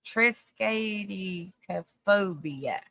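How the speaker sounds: Triskaidekaphobia sounded out very slowly, syllable by syllable; careful slow pronunciation. Real, not acted. Vlogger finally attempting the hard word for viewers, very slow. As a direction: drag out Triskaidekaphobia piece by piece. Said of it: "I will try my best".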